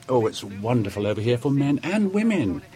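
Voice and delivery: ordinary talk, nothing marked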